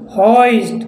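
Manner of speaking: usual speaking voice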